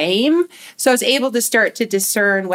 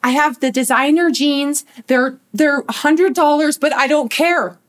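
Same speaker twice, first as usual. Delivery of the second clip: higher, hurried placating; imitated frantic mom; 6 semitones higher, 3 dB louder than usual. Mom comes running back with designer jeans, imitated frantic reassurance. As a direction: rush to fix it with promises.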